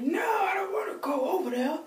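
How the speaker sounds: high, soft whimper of protest; mock tearful whine. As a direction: act out whining at Mom. Reluctant teen protesting to mom, upset.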